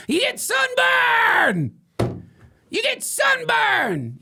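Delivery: very high, loud, slow yell; frantic shout. Acted. Ranter warns listeners about the sun, high slow shout. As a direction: scream the warning at everyone.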